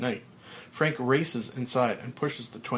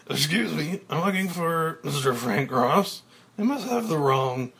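polite, puzzled, louder voice; confused stranger; 5 semitones higher, 4 dB louder than usual. Acted. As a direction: act baffled by the new Frank. Elliot confused, different voice.

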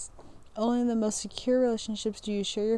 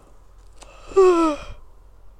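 loud sigh; 8 semitones higher, 11 dB louder than usual. Real, not acted. Big exhale after reading.

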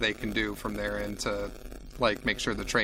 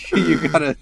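laughing; 8 semitones higher, 8 dB louder than usual. Real, not acted. Laughing at a critical fail.

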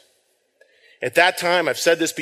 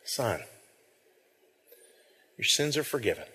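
much softer, tender words of Jesus; gentle, tender; 4 semitones lower, 11 dB quieter than usual. Acted. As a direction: say it like a gentle touch. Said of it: "That gentle touch".

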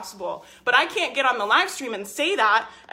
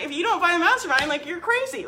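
higher, eager pitch for her offer; excited hype; 6 semitones higher than usual. Retold or imitated. Coach hyping her offer to live-stream viewers, excited. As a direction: sell it like it's obvious.

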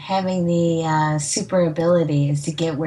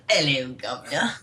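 mock Cockney accent; 4 semitones lower than usual. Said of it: "have a different accent".

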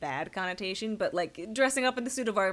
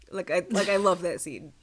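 ordinary delivery

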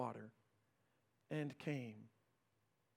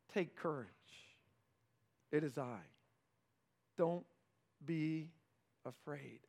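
reassuring; 6 semitones lower, 9 dB quieter than usual. Acted. Jesus calming disciples.

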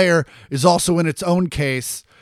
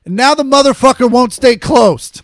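louder and higher, fed up; exasperated rant; 7 semitones higher, 9 dB louder than usual. Real, not acted. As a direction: blow up at the stubborn disc tray. Ranting about the broken Xbox.

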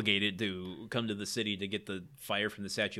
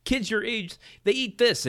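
angry yelling Tim; 9 semitones higher than usual. Retold or imitated. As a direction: yell at the kid like a furious dad. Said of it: "Tim's like".

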